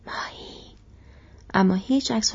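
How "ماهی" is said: very soft whisper close to the ear. A narrator's reading. Sam bends to sleeping Gollum's ear, soft whisper. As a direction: whisper it, barely audible. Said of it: "از نزدیک در گوش او گفت".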